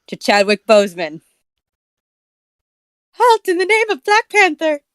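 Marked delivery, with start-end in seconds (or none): 3.1-4.8 high, commanding hero voice. Acted. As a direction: bark it like a comic book hero. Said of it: "what voice should I give him".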